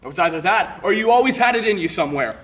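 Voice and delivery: loud, rising burst of blame; angry accusation. Acted. Accusing an ex-friend of always having it in them, angry. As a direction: throw the accusation out hard.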